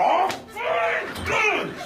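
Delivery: high, exasperated outburst. Acted. Passenger told by lift to stay calm, exasperated. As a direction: let frustration burst out, pitch leaping.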